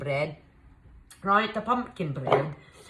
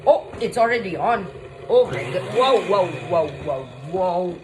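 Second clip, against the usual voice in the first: loud, startled outburst; startled alarm; 6 dB louder than usual. Real, not acted. Cook startled by the mixer already on, alarmed. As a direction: yelp at a machine running away from you.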